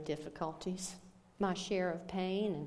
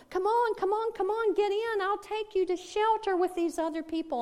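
much higher, louder call from the boat; eager woman rescuer; 12 semitones higher, 6 dB louder than usual. Acted. Powerboat woman calling the man on the roof to get in, high and urgent. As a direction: hurry him aboard, voice up high.

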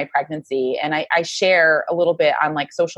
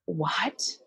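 bewildered; 11 dB quieter than usual. Retold or imitated. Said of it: "we were just so bewildered".

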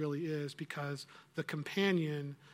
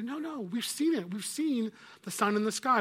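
louder, higher protest; insistent protest; 6 semitones higher, 5 dB louder than usual. Acted. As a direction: push back hard, we saw it. Children protest to the witch.

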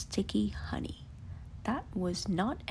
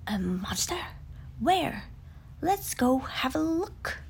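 eager exclaim; 3 semitones higher, 4 dB louder than usual. Acted. Tigger eagerly answers Piglet about the monster. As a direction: bounce into the adventure. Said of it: "Tigger exclaimed".

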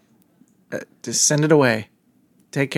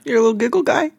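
put-on squealing baby talk aunt; 8 semitones higher, faster than usual. Teasing cohost as baby.

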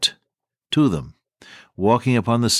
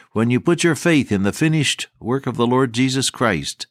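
normal talking voice

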